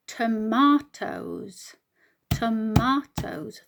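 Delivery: tomatoes split slowly into three syllables, to-ma-toes; slow teacher's syllable demo. Shown as a demonstration. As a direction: sound out tomatoes slowly, each syllable apart. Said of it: "three syllables".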